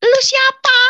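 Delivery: very high, loud yell; shouted demand. Retold or imitated. Woman demands who the voice is, shouting. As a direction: yell it at an unseen intruder.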